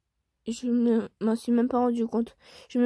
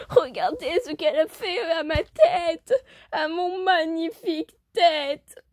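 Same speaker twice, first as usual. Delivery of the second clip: wailing pillar; 5 semitones higher, slower than usual. Acted. Pillar wails about burnt head.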